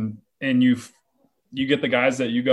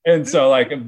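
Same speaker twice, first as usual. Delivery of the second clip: normal talking voice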